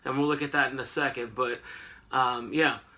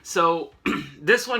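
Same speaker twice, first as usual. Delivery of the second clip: normal talking voice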